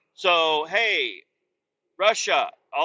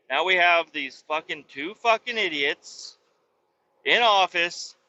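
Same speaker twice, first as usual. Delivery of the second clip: ordinary talk, nothing marked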